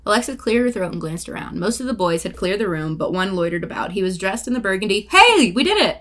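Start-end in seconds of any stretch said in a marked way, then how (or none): 5.1-6.0 high, loud burst of glee; excited cheer. Real, not acted. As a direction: cheer out loud, really pleased. Cheering mid-reading.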